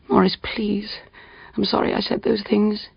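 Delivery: soft apologetic whisper to her husband; whispered plea. A narrator's reading. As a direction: beg him in a hushed voice. Betsy pleading in the night.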